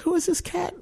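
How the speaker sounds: squeaky, quick comic outrage; mock indignation. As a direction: imitate an affronted inner voice in falsetto. Retold indignant thought, high squeaky.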